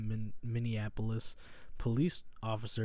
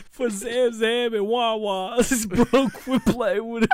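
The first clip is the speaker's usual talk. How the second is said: slow, higher imitating [name]; [name] impression; 10 semitones higher, 10 dB louder, slower than usual. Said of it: "[name] said".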